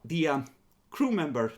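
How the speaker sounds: ordinary delivery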